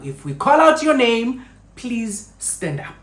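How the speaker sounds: loud, high official announcement to the room; announcer calling out. Retold or imitated. Interviewer announcing to candidates, loud and high. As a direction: call it out to the whole room.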